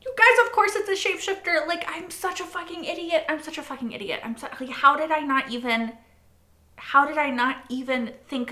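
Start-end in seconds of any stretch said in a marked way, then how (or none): 0.0-3.6 exasperated exclamation. Real, not acted. Telling her viewers about the twist, exasperated high outburst. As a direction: throw up your hands and tell them.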